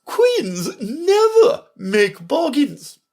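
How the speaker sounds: slow, loud, very high pronouncement; stiff, haughty. A narrator's reading. Red Queen refusing Alice's offer, stiff. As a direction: stand on royal dignity, high and slow. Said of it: "drew herself up rather stiffly and said".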